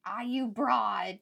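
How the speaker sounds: slow, drawn-out "Ah, you broad"; mock old-timey insult. Mock insult said slowly to a patient. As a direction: stretch out "broad" like an old movie tough. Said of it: "nobody's like".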